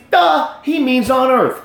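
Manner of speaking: slow, high-pitched duh; mock obviousness. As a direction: state the obvious with exaggerated emphasis. Mock obviousness while arguing.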